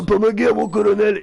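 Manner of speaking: a hint of Stallone's French voice; lightly voiced tough action hero. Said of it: "voix française de Stallone".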